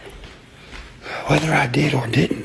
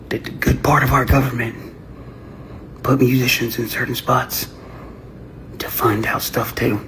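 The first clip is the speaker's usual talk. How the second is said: usual speaking voice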